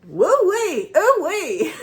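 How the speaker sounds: high, loud drawn-out "woo wee"; restaurant worker's whoop. Retold or imitated. Imitating the guy at the restaurant, high whooping. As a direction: whoop it out twice, imitating him as best you can. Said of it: "I'll try to do it as best as I can".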